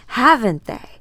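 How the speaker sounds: a cruel taunt thrown back in her face; mocking shout. Taunt repeated louder.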